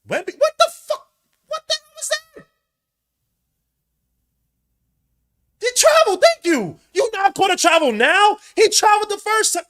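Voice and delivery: furious fan shouting at the refs; outraged yell. Real, not acted. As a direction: scream at the refs. Fan raging at a no-call.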